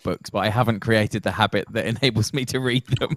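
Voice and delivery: real self-mocking amusement breaking into laughter; amused at self. Real, not acted. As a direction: confess it while starting to laugh. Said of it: "I'm laughing at myself".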